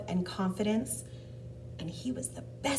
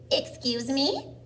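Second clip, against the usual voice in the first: higher, slow, questioning cricket; little cricket voice; 5 semitones higher, 3 dB louder, slower than usual. Acted. A cricket interrupts Gerald, small polite voice. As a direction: politely butt in as a tiny cricket. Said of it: "coughed a cricket".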